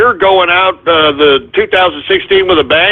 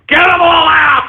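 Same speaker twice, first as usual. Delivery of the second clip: shouting; 7 semitones higher than usual. Real, not acted. Host yells at engineer to cut calls.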